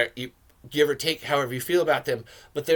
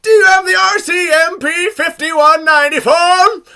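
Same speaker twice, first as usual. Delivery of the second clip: loud, high-pitched drill-sergeant yell; full-volume mock interrogation shout; imitated yelling; 12 semitones higher, 12 dB louder than usual. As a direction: holler it like you're drilling someone. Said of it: "yells at Travis".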